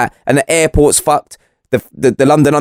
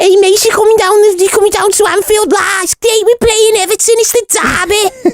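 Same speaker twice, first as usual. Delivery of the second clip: squeaky, exaggerated Liverpudlian accent; hammed-up Scouse football fan, very high; high-pitched mock Scouse. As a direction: push a whack Scouse accent right up high. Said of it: "Did I get that high pitch?".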